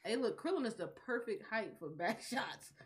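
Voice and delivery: ordinary delivery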